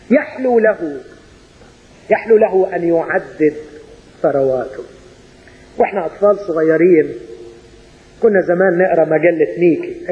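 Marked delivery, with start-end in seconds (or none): none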